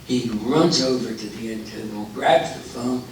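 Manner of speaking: usual speaking voice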